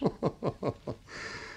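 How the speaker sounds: breathy little laugh; whispered laugh. Acted. Laugh after the rhyme.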